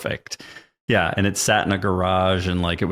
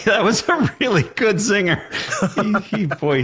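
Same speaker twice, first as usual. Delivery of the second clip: laughing; 12 semitones higher than usual. Real, not acted. Laughing while retelling.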